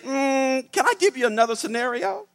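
high-pitched, hesitant-sounding polite question; acted diplomatic query; tactful challenge. Tactfully challenging Fred in retelling.

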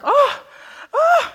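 high, loud mock moans like a TV actress. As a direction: ham up the breathy TV moan. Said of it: "those women would moan like".

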